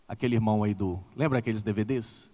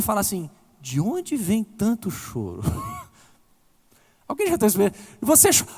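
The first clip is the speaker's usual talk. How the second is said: high, impassioned preaching voice, pushing each line up; preaching at full force; 9 semitones higher, 4 dB louder than usual. Acted. Pastor tells the congregation of his grave-side tears, impassioned preaching. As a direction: preach it like the tears are coming back.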